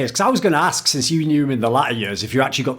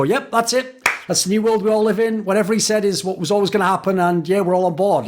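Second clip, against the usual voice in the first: higher, sing-song yes-man voice; mock resigned agreement; 5 semitones higher than usual. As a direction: parrot the company line like a yes-man. Mocking employees going along.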